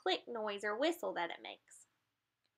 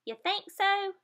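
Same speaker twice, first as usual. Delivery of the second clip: much higher questioning voice; doubtful dolphin; 9 semitones higher, 3 dB louder, slower than usual. Acted. Leaping dolphin asking the bird, high and doubtful. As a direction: ask it hopefully. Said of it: "the dolphin that's in the air says".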